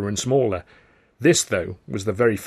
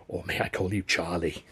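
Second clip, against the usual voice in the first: lower, softer, ingratiating; confidential ask; 4 semitones lower, 7 dB quieter than usual. A narrator's reading. Manager asks supposed star, confidential. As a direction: drop the voice to ask a favour.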